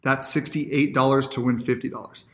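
ordinary talk, nothing marked

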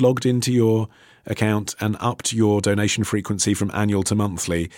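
normal talking voice

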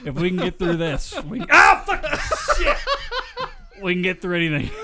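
yelping in pain while laughing; shocked mid-sentence. Real, not acted. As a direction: cry out from the jolt, then push on. Shocked mid-speech, screaming and laughing.

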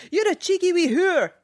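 high mocking Scottish schoolboy; schoolboy's taunt. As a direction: imitate a boy teasing a girl at school. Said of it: "heard a boy call a girl".

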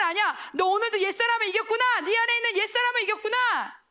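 high, bright voice of an approving God; God's praise. Acted. God telling the believer he won again, high and joyful. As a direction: cheer them on as God would.

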